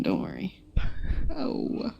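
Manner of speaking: whispering under breath; hushed aside; whispered. Real, not acted.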